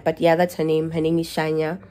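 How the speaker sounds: ordinary talk, nothing marked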